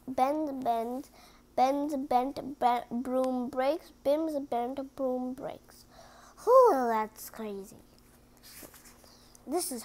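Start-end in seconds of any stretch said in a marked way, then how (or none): 4.0-5.8 slow careful whisper; hushed slow read. Real, not acted. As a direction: breathe the words out slowly. Tongue twister whispered.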